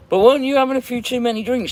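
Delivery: high, loud singsong ribbing; playful high-pitched jab at a friend; teasing. Real, not acted. Teasing a viewer about drinking.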